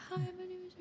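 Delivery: tiny, high-pitched timid greeting; barely audible doorstep introduction; too quiet. Shown as a demonstration. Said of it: "not talking loud enough".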